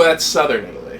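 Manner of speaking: ordinary talk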